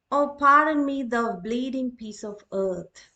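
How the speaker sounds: dramatic address. Shown as a demonstration. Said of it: "extract taken from Julius Caesar".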